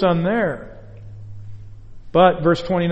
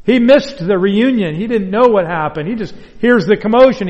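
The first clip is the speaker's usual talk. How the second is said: ordinary talk, nothing marked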